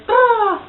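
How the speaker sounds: high blown lip sound; imitated raspberry. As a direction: blow a raspberry like a baby. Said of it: "she goes".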